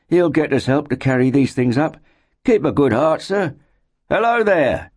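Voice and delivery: high-pitched encouragement rising into a hail; heartening loyal companion. A narrator's reading. Mark heartens his master and hails the stranger, high and encouraging. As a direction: keep his spirits up, then call out.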